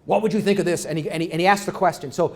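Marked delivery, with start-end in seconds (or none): 0.1-0.8 a hint of the booming talk-show moderator; John McLaughlin impression. Lecturer imitating a TV host posing a question, lightly mock host voice. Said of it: "he talks like this".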